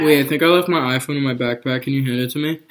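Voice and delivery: talking around a chip; speaking with snack at lips; chip in mouth. Real, not acted. Seen 1: Chip pressed against or partly in mouth throughout the words.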